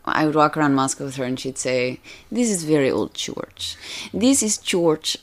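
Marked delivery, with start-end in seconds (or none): 2.3-5.2 put-on slow, careful Russian accent. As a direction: imitate a Russian teacher's accent. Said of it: "my Russian teacher always used to say".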